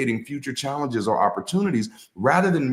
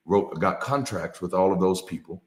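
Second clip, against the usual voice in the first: usual speaking voice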